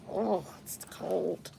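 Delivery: soft breathy whisper. Real, not acted. She feels the cold and whispers to herself. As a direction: gasp at the chill under the breath.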